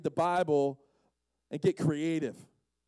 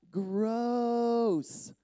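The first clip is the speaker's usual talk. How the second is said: drawn-out, higher-pitched disgust; 4 semitones higher than usual. Real, not acted. Preacher reacting to mud on the man's eyes, disgusted. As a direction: stretch the word out in disgust.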